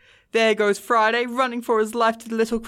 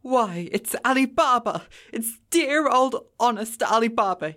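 between laughing and crying; 2 semitones higher than usual. A narrator's reading. Scrooge reliving his boyhood storybook heroes, excited and earnest. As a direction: exclaim in earnest delight, close to laughter and tears. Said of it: "extraordinary voice between laughing and crying".

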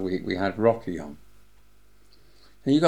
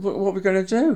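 panicked question; 10 semitones higher, 5 dB louder, faster than usual. Retold or imitated. Manager panics over snapped film.

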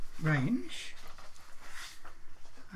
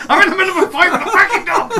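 fast, very loud, high-pitched comic shout; in-character yell; 15 dB louder, faster than usual. Acted. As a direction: bellow it frantically, hamming it up. Player yells back in character.

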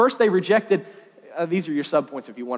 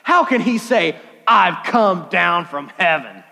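hammed-up slow mockery; mocking air quotes; 4 dB louder, slower than usual. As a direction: quote his claim with audible air quotes. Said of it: "they had to do air quotes here".

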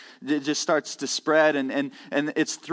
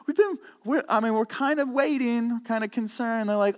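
ordinary delivery

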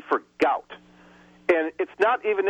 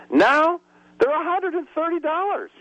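pitched way up in disbelief at the price; outraged; 13 semitones higher than usual. Real, not acted. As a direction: let the outrage lift your voice high. Price outrage in health care rant.

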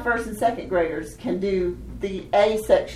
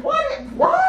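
mock disbelief. Teacher imitates kids taking rhymes literally, mock disbelief. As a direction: squawk it as a shocked mock question. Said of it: "They do, like".

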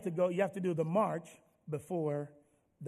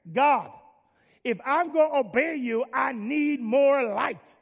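fervent prayer; 5 semitones higher, 7 dB louder, slower than usual. Acted. Joshua's impossible prayer to God.

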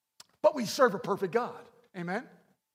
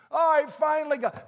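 mock relief; 8 semitones higher, 9 dB louder than usual. Relieved people answer God.

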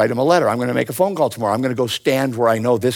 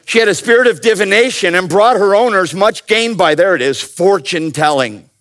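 preaching voice; 8 semitones higher, 6 dB louder than usual. Acted.

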